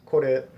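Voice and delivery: kore with a quick tapped R and short "eh"; correct Japanese pronunciation. Shown as a demonstration. Teacher repeating the model word, Japanese-style kore. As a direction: flick the R in kore, end short. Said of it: "the correct Japanese pronunciation".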